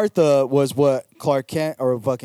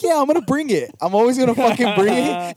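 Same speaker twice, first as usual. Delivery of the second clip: mock hype; 10 semitones higher, 5 dB louder than usual. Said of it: "I'm always just like".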